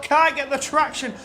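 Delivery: ordinary talk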